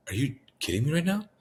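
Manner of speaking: high-pitched retold disbelief; incredulous. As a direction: pitch leaps up, as if you can't believe it. Retold disbelief at brother's spiritual explanation.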